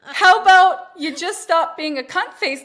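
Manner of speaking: high, loud, drawn-out telling-off; loud self-scolding. Retold or imitated. Mother telling herself to chill out with kids, loudly exasperated. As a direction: lay into yourself, hammed-up exasperation.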